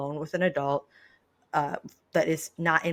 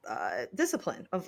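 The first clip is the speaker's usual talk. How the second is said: ordinary talk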